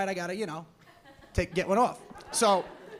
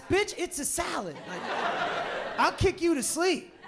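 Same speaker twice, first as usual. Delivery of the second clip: slow, high, fed-up comeback; exasperated retort; 6 semitones higher than usual. Retold or imitated. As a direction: snap back at the customer. Waiter's inner retort.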